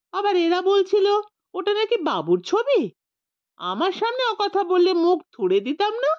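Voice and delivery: high, heated outburst from the outraged servant; furious shouting. A narrator's reading. Servant Kenaram yelling in outrage about the portrait, high and heated. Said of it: "ভীষণ ক্ষেপে চেঁচিয়ে বললে".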